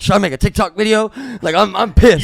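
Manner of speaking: loud, high retold venting; hot and pissed. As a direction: act out being worked up and fuming. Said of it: "and I'm hot".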